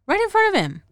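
right in front of him, high and indignant; incredulous. Real, not acted. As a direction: say it like you can't believe they did that. Repeats it in disbelief.

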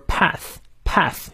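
"path" ending in th, tongue between the teeth. Shown as a demonstration. Teacher drilling the sound for learners, clear th in "path". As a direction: bite the tongue lightly on the th of "path". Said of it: "咬舌".

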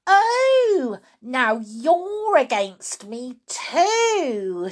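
high, loud "oh", then slow accusation; wounded, indignant outburst; shouting grandma. Acted. Grandma turns on her daughter, shouting indignantly. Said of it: "shouted Grandma".

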